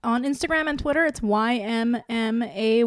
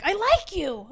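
high, openly keen blurt; mock over-earnest declaration of liking, higher voice; eager confession; 8 semitones higher than usual. Dater among game-players confessing openly, mock eager confession. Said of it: "I'm out here like".

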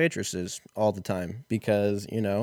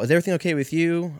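higher and fast, eager question; chirpy caller asking quickly; mock caller voice; 8 semitones higher, 5 dB louder, much faster than usual. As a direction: rush the polite check-in.

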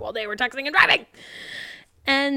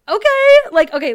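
much higher and louder Okay; exasperated outburst; 12 semitones higher, 12 dB louder than usual. Real, not acted. Reacting to Sophie's line, loud exasperated outburst. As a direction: burst out in disbelief. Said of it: "outburst after the line".

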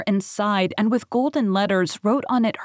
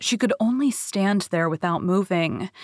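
usual speaking voice

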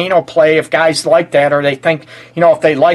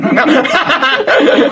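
high, hearty laugh; 9 semitones higher than usual. Real, not acted. Host enjoying the guest's frank advice, laughing. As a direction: crack up in a high voice.

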